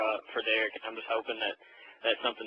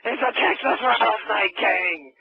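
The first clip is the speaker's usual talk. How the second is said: loud, high, drawn-out ringside hollering; hammed-up wrestling call; wrestling commentator impression; 8 semitones higher, 5 dB louder, slower than usual. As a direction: announce a shock entrance at full cry.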